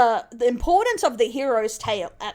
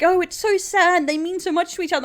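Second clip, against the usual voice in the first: mock sappy sobbing; 5 semitones higher, 4 dB louder than usual. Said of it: "Oh, like".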